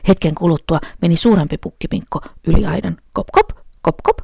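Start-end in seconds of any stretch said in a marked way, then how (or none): 3.1-4.2 bridge going kop. A narrator's reading. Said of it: "sanoi silta".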